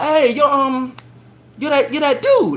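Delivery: starstruck greeting. Retold or imitated. Excited greeting to celebrity.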